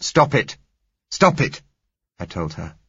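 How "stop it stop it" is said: loud, high, desperate cry; stop it shout. Acted. Narrator shouting at the pleading girl, loud and high. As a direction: shout her down before she says more. Narrator cuts off girl's plea.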